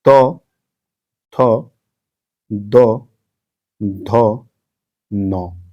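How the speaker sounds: त, थ, द, ध, न said singly with long gaps; dental sounds. Shown as a demonstration. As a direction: drill dental त, थ, द, ध, न, tongue on the upper teeth. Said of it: "दंत कहते हैं".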